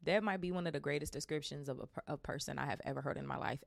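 ordinary delivery

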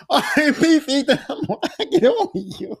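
high, cracking-up laugh through the words; laughing farewell. Retold or imitated. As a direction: play the quick goodbye while breaking into laughter. Acted mocking farewell through laughter.

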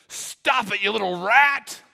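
loud furious shout at the dog; angry yell. Retold or imitated. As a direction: bark it out like an angry old man. Angry man yelling at his dog.

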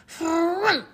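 loud, high cartoon grumpy "hmph"; hammed-up huff of annoyance; grumpy harrumph. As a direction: harrumph like a cross little panda. Grumpy panda harrumphs in story.

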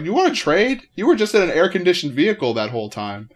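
high, loud mock indignation; acted-out fed-up comeback; exasperated retort. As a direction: throw it back at a cranky driver. Retorting to cranky drivers, acted.

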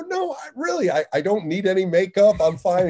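high whiny insistence; brushing off the boss in a put-on voice; mock protest. Telling the general manager he needs no makeup, mock whiny.

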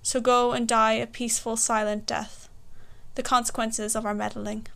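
ordinary talk, nothing marked

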